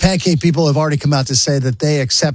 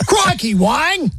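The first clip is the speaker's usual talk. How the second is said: Crikey, Wang! pitched much higher and slow, Crikey stretched; hammed-up Australian accent; 12 semitones higher, slower than usual. Joking about Australian Wangs, hammed-up higher Aussie accent. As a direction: ham up an Aussie exclamation. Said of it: "Australian accent".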